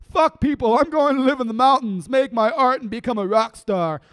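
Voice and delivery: furious poetic rant; defiant shout. Acted. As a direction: yell it out against the world. Shouting defiant poem lines.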